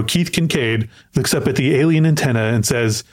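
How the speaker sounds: ordinary delivery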